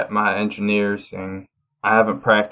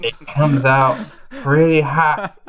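slow, higher innuendo delivery; dragging out the joke with a sly pitch lift; suggestive punchline; 6 semitones higher, slower than usual. Acted. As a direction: savour hot like a dirty joke. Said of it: "Oh, gross".

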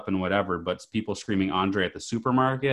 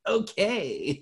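put-on high, small toddler "Okay"; imitating a small child answering; little kid voice; 11 semitones higher than usual. Dad imitating his little girl's okay. Toddler's reply in retold story.

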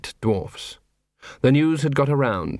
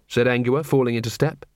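usual speaking voice

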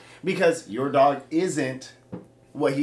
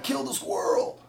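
whispered dog voice; 3 dB quieter than usual. Retold or imitated. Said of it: "they're like".

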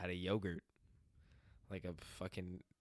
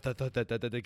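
imitated thuds; 4 semitones higher, 7 dB louder than usual. Imitating the falls again.

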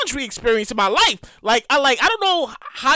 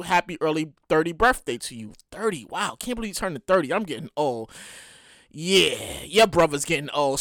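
tender wishes; 10 semitones lower, 6 dB quieter than usual. Real, not acted. Tender birthday wishes to sister.

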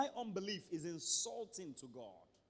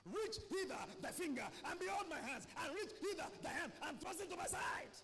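raised, ringing voice of Jesus; 10 semitones higher than usual. Acted. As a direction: proclaim it like Jesus. Preacher voicing Jesus's words.

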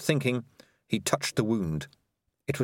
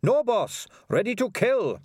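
eager high reply; 12 semitones higher, 4 dB louder than usual. Acted. Sardines answers the war cry.

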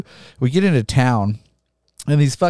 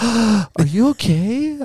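slow, higher imitation of a worried clerk; concerned staff; 9 semitones higher, much slower than usual. Rental staff to injured drunk customer, slow mock concern. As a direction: draw it out like a fretting clerk. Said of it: "They're like".

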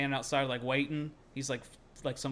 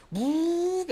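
high "woo" of a car taking off; high-pitched vocal car noise; imitated engine roar; 5 dB louder than usual. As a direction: mimic a revving getaway.

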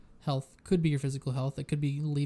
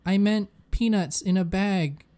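high, loud, frustrated whining; hammed-up annoyed user at a screen; exasperated searcher; 4 semitones higher, 9 dB louder, slower than usual.

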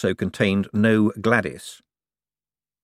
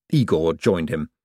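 ordinary delivery, nothing marked

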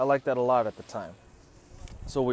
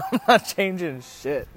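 pitched up and loud, breaking into laughter; laughing refusal; 6 semitones higher, 7 dB louder than usual. Retold or imitated. He refuses a second tux and haircut, laughing refusal. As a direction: crack up while retelling a stubborn refusal.

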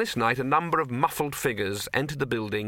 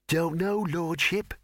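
nervous servant; 5 semitones higher, slower than usual. Acted. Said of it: "shivered with fear".

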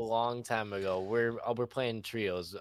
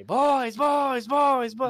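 high, loud rapid-fire calls; mock frantic yelling; 10 dB louder, much slower than usual. Reenacting the friend's call to his squad, mock frantic yell. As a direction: imitate a teammate yelling for backup.

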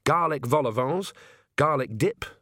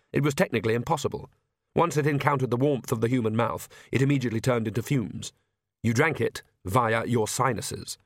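plain reading voice

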